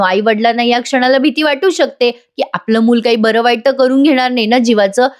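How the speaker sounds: ordinary talk, nothing marked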